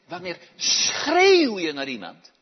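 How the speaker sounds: sudden loud burst on SCHREEUW. Shown as a demonstration. Acts out the word shout. (luid uitgeroepen)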